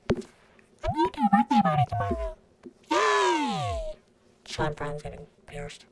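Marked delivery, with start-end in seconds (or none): 0.1-3.9 put-on high, squealy kid bragging; bratty kid voice. A kid bragging about a party, in a high squeal. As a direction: brag like a spoiled little kid.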